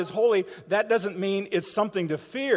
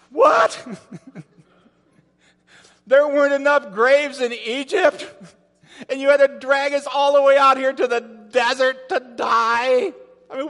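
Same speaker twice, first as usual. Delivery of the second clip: loud, high, exasperated griping at Moses; hammed-up mob of complainers yelling at their leader; whiny angry complaint; 7 semitones higher, 5 dB louder than usual. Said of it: "I'm gonna tell you what they said to Moses".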